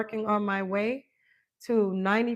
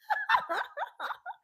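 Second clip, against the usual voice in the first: sudden high-pitched giggle; high laugh; 4 dB quieter than usual. Real, not acted. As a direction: burst into a squeaky laugh. Laughs at low battery.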